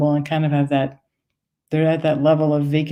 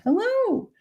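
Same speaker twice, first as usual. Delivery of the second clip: mock high hello, softer; high polite hello back; 4 dB quieter than usual. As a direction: echo her high greeting quietly. Teller answers in same voice.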